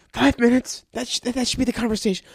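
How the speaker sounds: high, exasperated rant; indignant. Acted. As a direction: pitch it up in exasperation. Indignant about how it should go.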